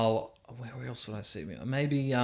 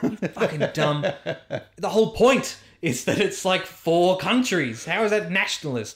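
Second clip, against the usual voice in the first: annoyed retort; 7 semitones higher, 5 dB louder than usual. Retold or imitated. Retorting the sister's comment.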